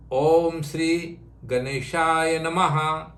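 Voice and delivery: Om Sri Ganeshaya Namaha, slow and even, each syllable drawn out; teacher slowly leading a mantra for the student to echo; slow mantra recital. Shown as a demonstration. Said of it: "Say with me".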